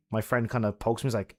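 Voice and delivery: usual speaking voice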